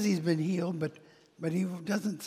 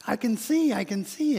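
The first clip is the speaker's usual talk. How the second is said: excited shout; 5 semitones higher, 5 dB louder than usual. Acted. Blind man shouting he can see.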